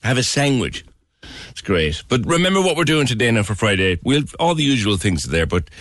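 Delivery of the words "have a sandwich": "sandwich" said with a G, as "sangwich"; Cork-style pronunciation. Retold or imitated. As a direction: imitate Cork speech, turning "sandwich" into "sangwich". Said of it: "There's a G in it".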